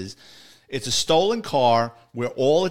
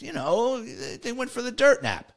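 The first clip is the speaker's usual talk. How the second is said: high, slow, coy informant voice; Tiny's coy hint; 12 semitones higher, slower than usual. Retold or imitated. Informant hinting to cops about a body, coy and high. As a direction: imitate an informant slowly letting a hint slip, pitched up. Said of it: "he said it just like this".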